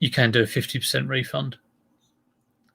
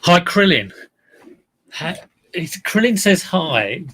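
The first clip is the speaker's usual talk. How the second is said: excited greeting; 6 semitones higher, 4 dB louder, slower than usual. Real, not acted. Greeting a viewer from chat.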